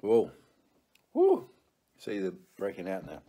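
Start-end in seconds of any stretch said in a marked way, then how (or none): 0.0-1.5 sour shock yelp. Real, not acted. Sour candy hits.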